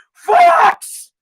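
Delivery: very high, drawn-out holler; yelled catcall. Acted. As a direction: yell it out the car window like Dad. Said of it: "foxy lady just yells".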